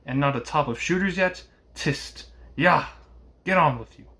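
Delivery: vexed coachman urging on horses. A narrator's reading. Coachman driving on tired horses, vexed. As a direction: raise the voice in impatient annoyance. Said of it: "ejaculated the vexed coachman".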